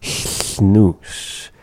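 Snooze said unvoiced; voiceless hissed. Shown as a demonstration. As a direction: whisper out Snooze. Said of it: "that word snooze".

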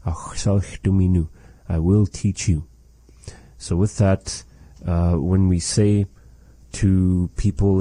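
ordinary talk

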